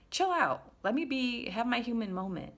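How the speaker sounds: high-pitched, exasperated plea; exasperated retort. Acted. Reply to people asking why you're crying, exasperated. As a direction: protest with pitch jumping up.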